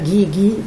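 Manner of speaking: "gui" repeated in a searching, questioning chant; repeated syllable. Shown as a demonstration. Teacher prompting a child to find words starting with gui, repeating. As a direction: keep repeating "gui" as if hunting for the word. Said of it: "On peut répéter la première syllabe".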